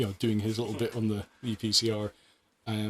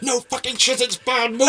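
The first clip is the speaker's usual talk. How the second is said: higher, louder exasperated yell; mock shout; 13 semitones higher, 10 dB louder than usual. Colleague yelling back at a bad scene, loud mock shout. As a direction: bellow it back in disbelief.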